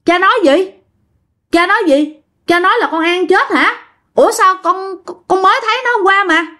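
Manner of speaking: high-pitched shocked questioning, retold; startled disbelief. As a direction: question Dad as if shocked by what you heard. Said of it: "ba mới hết hồn, mới nói".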